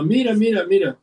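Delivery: put-on high, eager child's voice pointing something out; excited kids. Children in the next car calling to their mother, high and excited. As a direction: squeal it like a little kid tugging at mom. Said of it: "están diciendo a su mamá".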